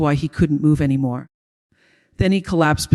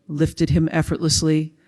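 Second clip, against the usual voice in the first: ordinary delivery, nothing marked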